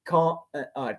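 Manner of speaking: ordinary delivery, nothing marked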